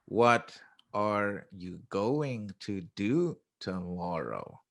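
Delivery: very slow, word-by-word classroom English; drawn-out textbook delivery, each word separate; classroom style. Shown as a demonstration. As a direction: sound out every word fully and slowly. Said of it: "I'm gonna say it in classroom style".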